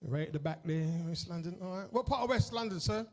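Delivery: usual speaking voice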